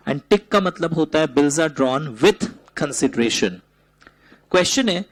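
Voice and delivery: ordinary talk, nothing marked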